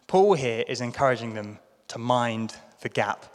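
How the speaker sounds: ordinary talk, nothing marked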